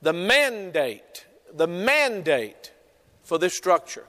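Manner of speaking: mandate repeated slowly for notes; slow, deliberate dictation. Shown as a demonstration. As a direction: say it slowly so they can write. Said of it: "I want you to write this down".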